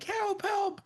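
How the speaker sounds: very high, thin little cry for help; trapped girl calling. Retold or imitated. Girl calling to her parents through the walls, high mock cry. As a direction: imitate a lost girl's high-pitched calls.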